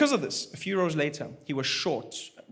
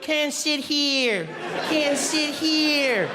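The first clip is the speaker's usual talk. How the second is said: high, very slow mock refusal; passengers refusing; 9 semitones higher, 4 dB louder, much slower than usual. Passengers refuse to sit by the newcomers, high and very slow. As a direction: drag out each word like a frightened passenger. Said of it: "Everyone was like".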